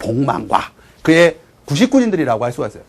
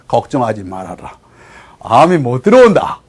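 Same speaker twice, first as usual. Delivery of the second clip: reassuring king; 6 semitones lower than usual. Acted. King calming a fleeing minister, reassuring. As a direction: soothe with unhurried royal assurance.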